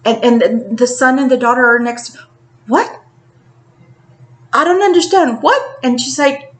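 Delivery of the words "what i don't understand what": higher, rising mock disbelief; a retold stunned wife's questions; shocked disbelief. Wife told her husband died, mock shocked disbelief.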